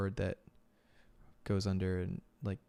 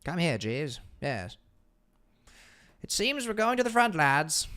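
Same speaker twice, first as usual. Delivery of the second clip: posh British; 8 semitones higher, 8 dB louder than usual. Pretend. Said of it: "Maybe not quite that posh".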